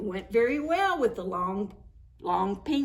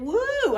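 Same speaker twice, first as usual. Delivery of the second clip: hot woo; 13 semitones higher, 5 dB louder than usual. A narrator's reading. Snowman too hot.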